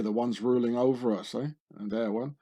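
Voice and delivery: usual speaking voice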